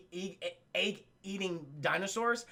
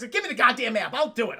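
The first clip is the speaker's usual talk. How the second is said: raised, pushy demand; 3 semitones higher, 6 dB louder than usual. Retold or imitated. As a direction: snap it out impatiently. Demanding the map, retold.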